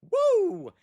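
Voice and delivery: high-pitched "woo" of celebration; celebratory whoop. Real, not acted. Cheering Bryce Eldridge's home run, celebratory whoop. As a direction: let out one high, gliding cheer.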